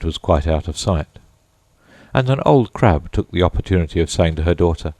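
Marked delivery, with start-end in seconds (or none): none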